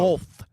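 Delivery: "both" as "bolth", tongue out on the th. Shown as a demonstration. Host explaining his tongue position, "both" as "bolth". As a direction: put an L before the th in "both". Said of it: "when I stick my tongue out".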